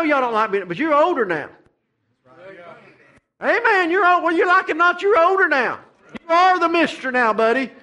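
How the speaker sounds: high, loud exhorting preaching; full-force preaching. Acted. Preacher telling older members they are the Mister now, loud preaching. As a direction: drive it home to the older men.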